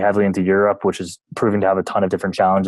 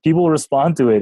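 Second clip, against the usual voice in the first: insistent; 7 semitones higher than usual. Retold or imitated. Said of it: "I'm like".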